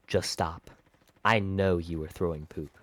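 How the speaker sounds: low, quiet telling-off, retold; stern teacher. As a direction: shut the kid down, low and soft. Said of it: "and she goes".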